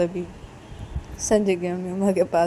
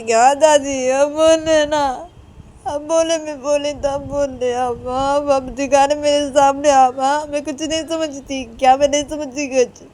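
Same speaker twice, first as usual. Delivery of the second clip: loud, high, drawn-out whining; acted upset protest; hurt retort; 7 semitones higher, 9 dB louder, slower than usual. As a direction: answer back in a tearful wail. Upset Uma answers back.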